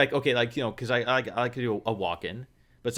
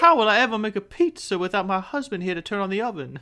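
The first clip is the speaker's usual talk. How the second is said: higher-pitched, helpless Southern debutante; Southern debutante accent; 9 semitones higher than usual. Acted. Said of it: "I go Southern".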